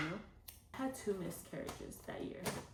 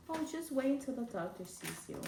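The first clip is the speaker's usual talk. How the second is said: lightly voiced technician; 6 semitones higher than usual. Technician voiced putting patient off.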